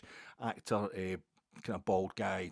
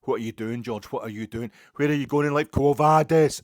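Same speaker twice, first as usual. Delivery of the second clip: loud shouting, building higher; loud shouting heckle that climbs higher at the end; heckler's yell; 4 semitones higher, 15 dB louder than usual. Retold or imitated. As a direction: heckle the screen, getting wilder. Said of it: "he just started going".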